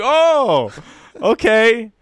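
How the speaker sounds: high, loud oh okay; imitated deflated man. Storyteller imitating the man's reaction to his denial. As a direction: let it land like mild disappointment.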